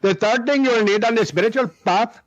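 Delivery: put-on Indian-accented guru voice. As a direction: imitate the Indian teacher's accent. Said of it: "That's why I do the accent".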